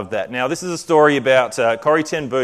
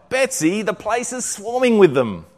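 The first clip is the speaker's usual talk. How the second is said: alarmed; 9 semitones higher than usual. A narrator's reading. Corrie alarmed at the fleas.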